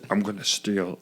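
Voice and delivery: very low, very soft impression; deep, quiet attempt at an actor's voice; attempted Nick Cage. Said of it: "say this line as Nick Cage".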